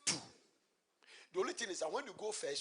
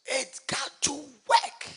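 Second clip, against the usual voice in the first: slow, loud, higher insistence; emphatic declaration; 10 semitones higher, 18 dB louder, much slower than usual. Acted. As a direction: stretch each word like a decree. Preacher's climactic declaration.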